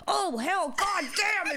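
put-on high, loud startled old-man cry; imitating old man. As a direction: squeal it like a shocked old man. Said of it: "the way he said".

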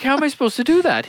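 quick, high-pitched protest; incredulous protest. Retold or imitated. Son protesting to his dad about the gas, high quick disbelief.

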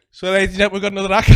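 compere announcement. Retold or imitated. Comedian retells introducing a crying woman's partner as an act, loud compere voice.

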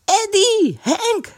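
high-pitched calling yell; high, drawn-out holler. A narrator's reading. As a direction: yell the names out across the ice. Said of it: "gilde Kees nog eens".

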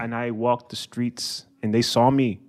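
soft, low London tale drifting Irish; mock-grave storyteller in a London-Irish accent. Shown as a demonstration. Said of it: "like, Irish at this point".

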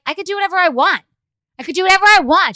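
loud defiant insistence, rising; defiant retort. Retold or imitated. Mom defying daughter after concert, loud and defiant. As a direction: shout it back at her, defiant. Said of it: "I'm like".

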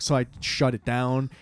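usual speaking voice

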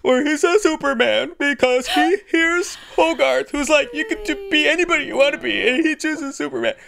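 telling her favorite movie moment, voice breaking with tears; tearful, choked up. Real, not acted. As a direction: fight back tears while describing the scene. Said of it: "Let's just have a cry fest".

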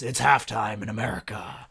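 hint of a screen icon's ad read; lightly imitated ad voiceover. Co-host quoting the star's Super Bowl commercial, lightly imitated voiceover. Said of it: "and he says".

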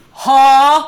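hammed-up failure to hear; mock oblivious husband. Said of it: "And they're like".

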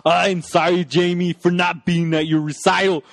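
put-on action-star dad apology; mock a heavily acted movie father; Schwarzenegger. Host as dad apologising to Jamie, hammy impression. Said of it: "he's like, he's like".

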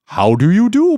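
high, loud wavering jellyfish; wobbly floating jellyfish. Acted. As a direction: call out sweetly as a jellyfish. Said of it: "he raised his voice and said".

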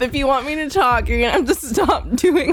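giggling, breathy plea to stop; real laughter while scolding; laughing protest. Real, not acted. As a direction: beg through laughter. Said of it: "fucking stop".

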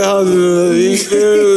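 drawn-out mock question. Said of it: "Cuz say".

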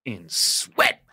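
emphatic dance teacher's climax; monologue punchline. Retold or imitated. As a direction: land it hard after a long theatrical pause. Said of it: "Long pause".

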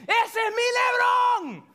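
high, drawn-out full-voice scream; screaming proud dad. Retold or imitated. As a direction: yell it like a dad cheering from the stands. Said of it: "that's what he's screaming".

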